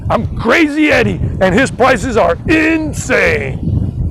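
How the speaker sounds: frantic TV pitchman, last word drawn way out; hammed-up electronics store commercial shout; Crazy Eddie. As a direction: sell it like a manic eighties ad, stretching the final word. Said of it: "remember that Crazy Eddie commercial".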